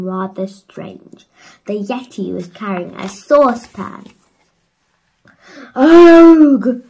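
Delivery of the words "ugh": high, very loud ugh scream; disgusted scream. A narrator's reading. Villagers recoiling from the Yeti, loud screamed ugh. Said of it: "screamed everyone".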